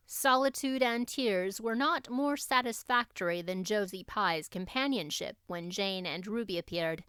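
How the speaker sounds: usual speaking voice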